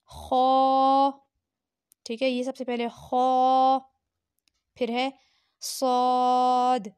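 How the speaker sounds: खौ सौद वाद आइन गैन ज़ा क़ाफ़ said loud and heavy with a full mouth, drawn out; heavy letters said with a full mouth. Shown as a demonstration. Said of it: "मुंह भर की आवाज़ निकाली जाती है".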